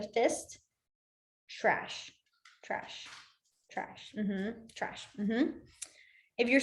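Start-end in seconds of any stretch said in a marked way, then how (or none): 1.5-5.5 near-silent whisper of trash. Shown as a demonstration. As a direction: breathe trash softly as you sign. Mouthing signs to class.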